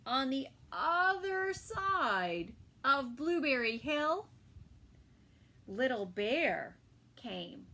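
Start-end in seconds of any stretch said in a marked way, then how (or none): none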